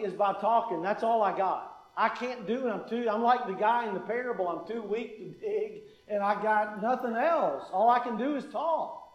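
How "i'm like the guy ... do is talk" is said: ordinary talk, nothing marked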